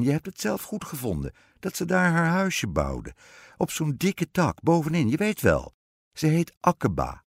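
high, insistent queen voice; storybook queen. A narrator's reading. Queen voice in read fairy tale.

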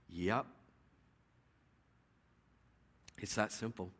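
low, quiet, understated answer; matter-of-fact assurance. Real, not acted. Preacher answers the believers' protest, quietly certain. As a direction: drop right down and settle the question.

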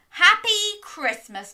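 high, loud, slow happy greeting; cheerful greeting. Acted. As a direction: beam it out to Mog. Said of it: "said Debbie".

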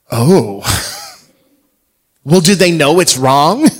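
hammed-up kid asking in disbelief; child's surprise. Dad voicing his daughter's loud surprised question.

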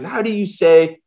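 hint of a German accent, searching for English; mildly put-on German-accented English. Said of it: "'cause his English wasn't great".

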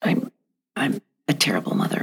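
fast, hushed whisper of self-blame; whispered self-criticism. Retold or imitated. Mother voicing her inner critic to the listener, whispered. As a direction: let it slip out as a guilty whisper. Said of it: "if I'm saying to myself".

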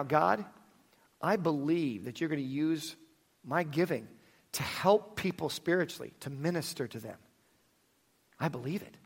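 soft, hushed prayer voice; quiet prayer. Acted. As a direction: pray it softly and sincerely. Leads a prayer.

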